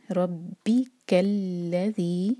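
रब्बिकल्लज़ी very slow, heavy रॉ, strong ब; recitation demo. Shown as a demonstration. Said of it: "बा strong". (रब्बिकल्लज़ी धीमी तिलावत)

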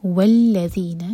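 والذین with a true dh and doubled lam; clear dhal. Shown as a demonstration. Teacher models والذین for the class. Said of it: "ذال کی طرح پڑھیں گے".

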